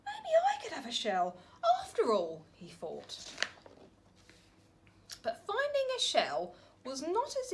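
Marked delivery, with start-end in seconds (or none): 0.1-2.5 higher, faster sudden hope; light up with the idea; hopeful idea. Acted. Norman gets an idea.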